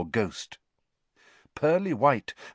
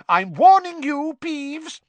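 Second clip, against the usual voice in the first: loud, very high stern warning; McGonagall shouting; 9 dB louder, slower than usual. A narrator's reading. Teacher warns the poltergeist, shouting. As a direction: shout a last warning at a troublemaker. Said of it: "shouted Professor McGonagall".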